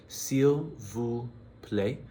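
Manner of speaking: slow, careful "S'il vous plaît" with a rise; teacher modelling the most formal please. Shown as a demonstration. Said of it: "the most formal".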